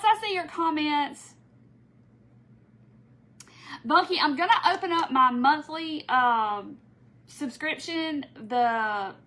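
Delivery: ordinary delivery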